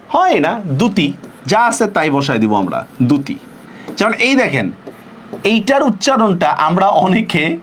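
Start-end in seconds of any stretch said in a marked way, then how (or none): none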